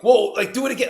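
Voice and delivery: put-on high, loud, kid-like amazement; asking for the magic trick again; excited. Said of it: "I was like".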